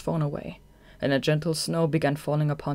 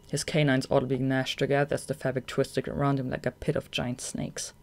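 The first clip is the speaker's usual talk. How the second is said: ordinary delivery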